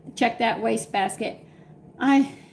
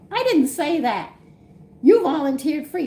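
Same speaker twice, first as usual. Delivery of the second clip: raised high, loud protest, retelling the row; retold indignant yell; 7 semitones higher, 7 dB louder than usual. Retelling telling off the driveway salesman, loud indignant protest. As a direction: fire it back like you're still annoyed.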